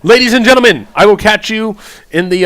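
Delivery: loud, high showman's call; big, projected high-pitched announcement; announcer sign-off. Acted. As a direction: belt it out like time has just run out. Showy sign-off as time runs out.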